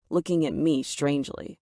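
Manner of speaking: usual speaking voice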